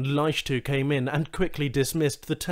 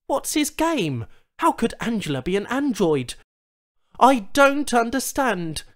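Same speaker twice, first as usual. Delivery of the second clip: bewildered; 10 semitones higher, 5 dB louder than usual. Acted. Charl confused and upset.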